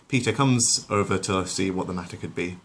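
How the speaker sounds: plain reading voice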